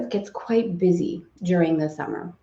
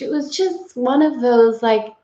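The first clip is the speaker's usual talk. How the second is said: normal talking voice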